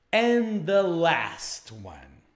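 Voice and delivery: very slow, stretched-out "And the last one"; slow drawn-out. Shown as a demonstration. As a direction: draw out "And the last one" word by word. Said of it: "teacher repeats phrase twice".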